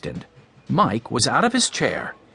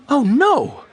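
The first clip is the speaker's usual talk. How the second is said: high, louder cry of dismay; 12 semitones higher, 5 dB louder than usual. A narrator's reading. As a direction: cry out in protest. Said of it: "cried Missus Wood".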